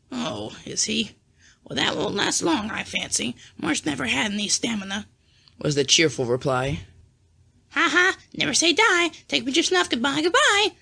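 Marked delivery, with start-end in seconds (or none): 0.1-5.1 lightly voiced sour old aunt. A narrator's reading. Aunt March dismissing Father's health, lightly voiced. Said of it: "asked the old lady gruffly". 7.7-10.8 squall like a dancing parrot. A narrator's reading. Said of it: "squalled Polly".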